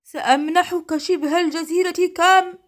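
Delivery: high, loud desperate promise; genie shouting. A narrator's reading. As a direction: holler it as you are hurled away. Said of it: "العفريت يصرخ".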